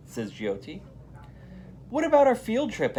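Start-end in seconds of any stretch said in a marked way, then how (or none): 1.9-3.0 put-on higher, louder anxious kid's question; a nervous boy pleading about the trip; lightly voiced worried schoolboy. A narrator's reading. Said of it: "asks Arnold".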